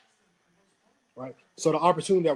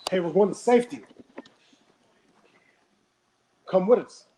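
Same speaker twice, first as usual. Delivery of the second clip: calling up loudly from the boat, a bit higher; yelling up; 3 semitones higher, 6 dB louder than usual. Retold or imitated. Rescuers in a boat yelling up to the stranded man, loud call. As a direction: shout it up the mountain to him. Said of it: "they yell up to him".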